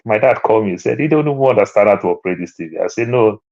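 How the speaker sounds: ordinary talk, nothing marked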